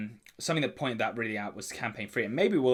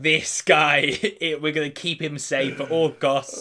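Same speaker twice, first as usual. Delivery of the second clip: raised, louder mock devotion; mock player enthusiasm; 3 semitones higher, 6 dB louder than usual. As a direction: play eager players rallying round a favourite. Voices players adopting a favourite character.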